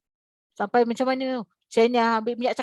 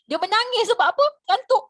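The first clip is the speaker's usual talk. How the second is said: high, loud teasing reveal; spring the joke on the class; high-pitched, louder comic reveal of sleepiness; playful punchline; 11 semitones higher, 6 dB louder than usual. Real, not acted. Punchline about sleepy crying.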